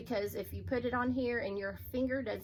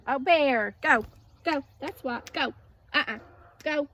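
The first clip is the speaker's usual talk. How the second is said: loud "go" at the dog; shooing the dog; 3 semitones higher, 10 dB louder than usual. Real, not acted. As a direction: send the dog off, firm.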